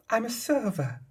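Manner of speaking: sugary simpering server voice, fluttered and tittering; ingratiating escort. Acted. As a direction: mimic a fawning escort, tittering on the words. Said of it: "fluttered her voice with a titter".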